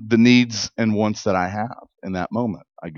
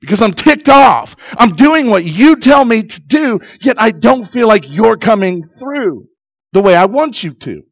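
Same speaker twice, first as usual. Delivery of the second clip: higher and louder, ticked-off demand; mad prayer; 8 semitones higher, 4 dB louder than usual. Retold or imitated. Pastor retelling his angry prayer to God, higher and louder. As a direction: insist like you're fed up with God. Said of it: "I was so mad at God".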